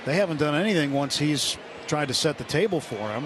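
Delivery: ordinary talk, nothing marked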